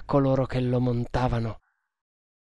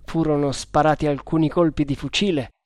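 plain reading voice

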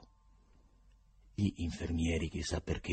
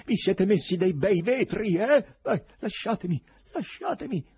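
high, strained, frantic cries; desperate high-pitched yelling; shouting while struggling; 13 semitones higher, 5 dB louder than usual. A narrator's reading. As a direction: start loud, then plead to be released.

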